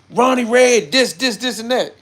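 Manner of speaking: high, loud mock fussing; mock outburst. Streamer acting out the expected reaction about Ronnie Red, mock outburst. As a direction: yell it like an exaggerated reaction.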